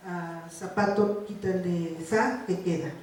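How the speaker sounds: put-on slow, deliberate prompt to a child; slow test prompt. Shown as a demonstration. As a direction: spell out the task slowly, as to a small child. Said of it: "eliminar un fonema". (consigna lenta y pausada)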